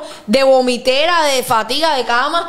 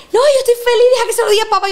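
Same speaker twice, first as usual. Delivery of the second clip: imitating sister; 9 semitones higher, much faster than usual. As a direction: play the thrilled young sister. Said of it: "quoting sister". (imitando a la hermana feliz)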